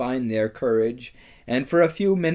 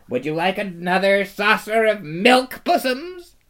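high, sing-song mock sweetness; baby-talk the lion to insult him; jeering; 7 semitones higher, 4 dB louder than usual. A narrator's reading. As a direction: rabble taunting Aslan. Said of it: "jeering at him".

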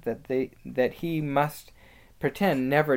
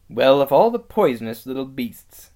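loud, disgusted scolding; appalled anger, loud at the start; indignant outburst; 9 dB louder than usual. Acted. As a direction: denounce the culprit with force. Peter denouncing Edmund.

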